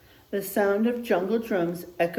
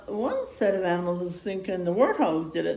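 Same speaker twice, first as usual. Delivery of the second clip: usual speaking voice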